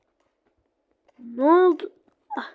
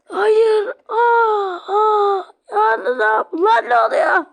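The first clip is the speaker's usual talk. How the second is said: high, loud wailing panic; a ragdoll screaming as it gets attacked; panicked crying shout; 5 semitones higher, 3 dB louder, slower than usual. Acted. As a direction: cry out in terror while being hit.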